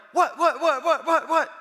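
high, loud rapid-fire what with a laugh; jolted dad firing back questions; startled dad. Retold or imitated. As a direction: play the alarmed father, half laughing.

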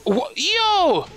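sudden high disbelieving yelp; shocked yelp. Real, not acted. Player shocked when his duck fails, high yelp. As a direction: jolt up in pure surprise.

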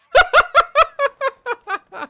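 loud high-pitched laugh; bursting out laughing. Real, not acted. Laughing at own joke.

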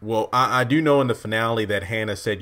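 normal talking voice

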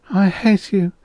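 high, moaning voice; moaned. A narrator's reading. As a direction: moan it out, pitched far up. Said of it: "she moaned".

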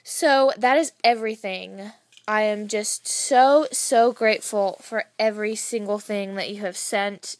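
loud, overflowing gratitude; excited thanks. Real, not acted. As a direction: burst out with thanks. Thanking friend for gifts.

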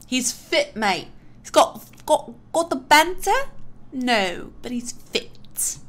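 lightly done British lad sizing up a guy; British lad accent. Pretend. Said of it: "bad British accent".

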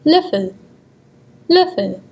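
Löffel with a rounded ö; German pronunciation. Shown as a demonstration. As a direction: say Löffel, lips slightly rounded. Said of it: "Here is an example word".

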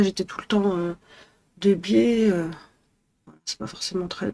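ordinary talk, nothing marked

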